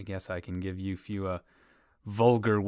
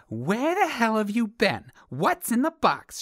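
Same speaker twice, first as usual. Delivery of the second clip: much higher, loud angry shriek; demanding wife; 6 dB louder than usual. Retold or imitated. [name] voices the angry wife in his joke, shrieking. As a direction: screech at him like a furious wife. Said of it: "she demands".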